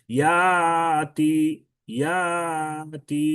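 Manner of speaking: ইয়াতি with a long drawn আ, very slow; madd badal demo. Shown as a demonstration. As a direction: stretch ইয়াতি as the hamza becomes alif. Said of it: "হামজাকে আলিফ দ্বারা পরিবর্তন করে পড়া". (ইয়াতি টেনে মদ করে)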